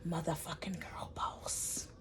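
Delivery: slow hushed whisper; a slow, hushed aside to her friend. Retold or imitated. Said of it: "Blue turned to her and was like".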